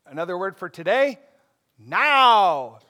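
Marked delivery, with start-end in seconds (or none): 1.8-2.8 now punched louder; now proclaimed loud; emphatic now. Retold or imitated. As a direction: stress now as a declaration. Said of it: "he began to say to them".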